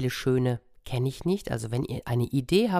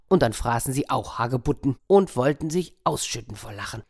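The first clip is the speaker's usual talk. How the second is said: ordinary delivery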